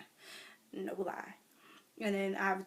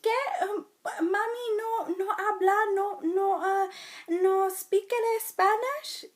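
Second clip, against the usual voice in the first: put-on Spanish accent; 12 semitones higher, 9 dB louder than usual. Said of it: "they'll always be like".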